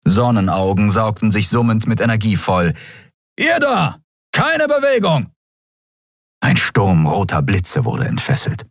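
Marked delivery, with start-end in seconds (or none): 3.4-5.3 high-pitched battle shout; shouted challenge. A narrator's reading. Enemy ordering the heroes to freeze, shouted challenge. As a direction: bark an order to halt.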